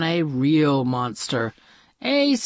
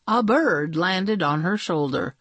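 plain reading voice

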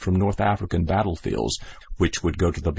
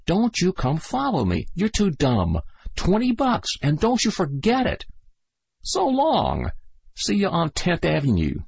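higher, brash goodbye; cocky soldier farewell; 10 semitones higher than usual. Acted. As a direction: brush him off with a grin. Sligo's voice.

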